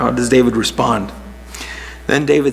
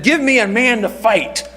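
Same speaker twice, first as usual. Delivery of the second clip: Goliath's mocking challenge, pitched higher; taunting giant; 9 semitones higher than usual. Giant taunting the Israelite army, raised mocking call. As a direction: jeer it like a scornful giant. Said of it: "he had taunted them".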